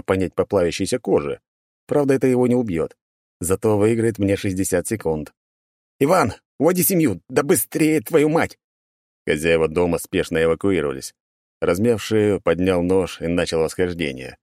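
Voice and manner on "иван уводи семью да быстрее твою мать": high, urgent yelling; urgent shout. Acted. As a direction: yell at Ivan to hurry. Calling out to Ivan.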